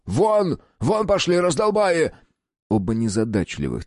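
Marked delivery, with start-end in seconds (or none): 0.1-2.1 high-pitched furious order; yelling. A narrator's reading. Boss throws his slow bodyguards out, yelling. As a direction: yell it out, voice jumping high. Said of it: "заорал".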